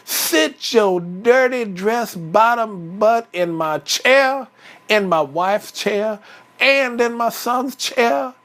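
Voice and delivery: mad Papa Bear. Acted. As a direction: tell off the intruder, punching each phrase. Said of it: "Papa Bear got mad".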